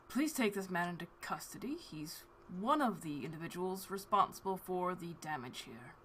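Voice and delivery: soft, restrained order to guards; lightly voiced official father, kept quiet; reserved father. Father handing his son to the guards, quiet and reserved. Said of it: "and then to the guards".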